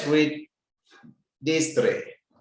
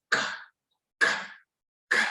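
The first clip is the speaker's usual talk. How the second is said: ordinary talk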